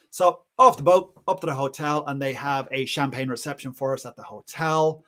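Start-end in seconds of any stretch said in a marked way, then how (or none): none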